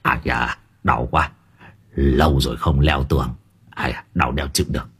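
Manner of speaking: put-on low, soft old man's groan; hurting and sorry for himself; groaning in pain. A narrator's reading. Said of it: "tiếng của ông cụ Tính vang lên".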